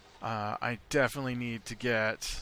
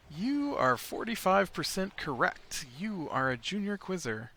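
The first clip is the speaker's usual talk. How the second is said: mock quiz result; 7 semitones higher, 5 dB louder than usual. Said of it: "and it's like".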